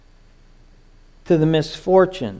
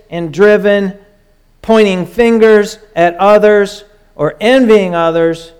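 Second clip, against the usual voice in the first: louder, rising preacher's cadence; full-force preaching; 3 semitones higher, 4 dB louder than usual. Acted. Preacher exhorting the church, loud and insistent. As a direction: drive it home to the congregation.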